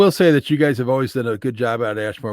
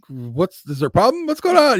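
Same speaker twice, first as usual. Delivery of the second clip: builds from plain to a high, louder worried question; mock worried Mike; 8 semitones higher, 5 dB louder than usual. Friend imitating Mike, worried when texts go unanswered, rising panic. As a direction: play Mike getting more panicked with each question. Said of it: "And he's like".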